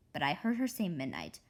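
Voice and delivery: usual speaking voice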